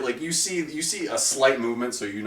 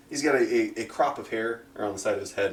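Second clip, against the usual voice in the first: ordinary talk, nothing marked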